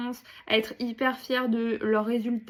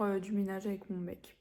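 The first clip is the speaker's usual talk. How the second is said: whisper; 3 semitones lower, 8 dB quieter than usual. Real, not acted. Quiet aside to camera.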